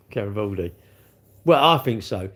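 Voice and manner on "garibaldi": laughing it out, softer. Real, not acted. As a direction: chuckle as you say Garibaldi.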